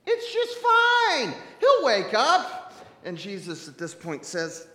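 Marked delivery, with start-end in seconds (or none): 0.1-2.7 loud, high, drawn-out cheery reassurance; clueless disciples. Acted. Disciples answering Jesus about Lazarus, oblivious.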